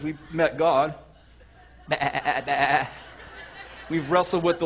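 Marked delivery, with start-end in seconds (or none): 1.9-2.9 low, wavering mock bleats; sheep bleat. Imitates a limping sheep.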